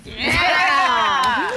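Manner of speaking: loud, high whoop of support; big, high-pitched cheer; cheering shout. Real, not acted. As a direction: cheer your friend on at full volume. Cheering the friend's proud declaration.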